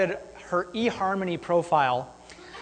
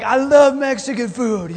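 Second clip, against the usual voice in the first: mock food lover; 4 semitones higher, 5 dB louder than usual. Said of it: "so, like".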